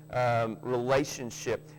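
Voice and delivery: ordinary talk, nothing marked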